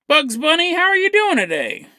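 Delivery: jokey doctor greeting a patient, high-pitched; acted doctor. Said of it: "a doctor walks in and goes".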